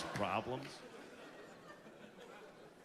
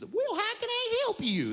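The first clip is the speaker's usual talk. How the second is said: high, slow Texan drawl; sweet Texan operator, pitched way up; hammed-up Southern phone lady; Texas lady; 3 dB louder than usual. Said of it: "the lady from Texas".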